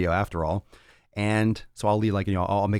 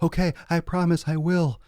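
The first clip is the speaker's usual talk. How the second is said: much higher, eager little promise; meek promise; 9 semitones higher than usual. Pretend. Lazy friend promising the vision, high and eager. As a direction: squeak it like a chastened friend.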